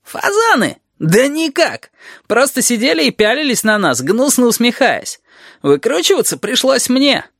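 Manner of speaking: high-pitched sarcastic griping; aggrieved lizard. A narrator's reading. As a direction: complain hotly, capped with a bitter tag question. (ящер, возмущённо жалуется)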